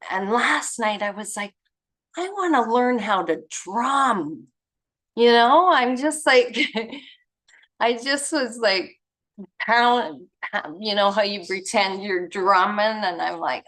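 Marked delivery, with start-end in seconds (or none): 2.2-13.0 higher and louder, bubbling over about drumming; excited. Retold or imitated. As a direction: gush about wanting to learn drums, laughing. Excitedly telling of wanting to drum.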